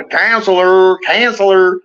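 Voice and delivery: high, drawn-out mock Southern call; Cape Fear Southern accent. Podcaster echoing De Niro in Cape Fear, mock Southern accent. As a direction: stretch it out in a hammy Southern twang. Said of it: "like the Cape Fear accent".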